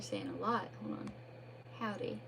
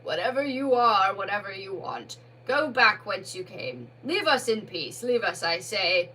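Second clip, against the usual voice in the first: commanding cry; 6 dB louder than usual. Acted. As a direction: Badger orders the ghost away, booming. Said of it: "he cried out".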